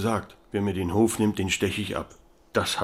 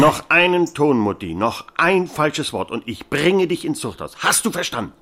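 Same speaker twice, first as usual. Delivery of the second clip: higher, louder furious warning; angry threat; 9 semitones higher, 7 dB louder than usual. Acted. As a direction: threaten mother sharply. Son threatens his mother. (wütend drohend)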